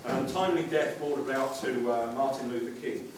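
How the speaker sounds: mock-serious documentary voiceover; solemn documentary narration. Mock documentary narration, lower and softer.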